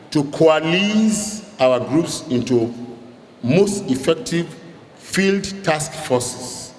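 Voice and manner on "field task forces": "field task forces" said slowly, each word weighted and separated; stress on field task forces. Real, not acted. Director instructing support groups, slow stressed "field task forces". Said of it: "Emphasis on the word field task forces".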